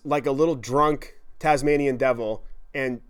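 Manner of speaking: ordinary talk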